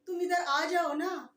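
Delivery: loud, careless coaxing of a friend; rough casual Hindi. Shown as a demonstration. Teacher mimicking impolite talk to a friend, rising loud calls. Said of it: "ऐसे बोलते हैं ना टूटी-फूटी".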